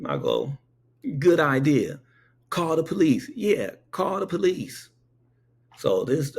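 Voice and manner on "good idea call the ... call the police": higher-pitched, lively retold agreement; acted-out eager comeback; emphatic retort. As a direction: egg him on, pitched up. Acting own emphatic reply to him.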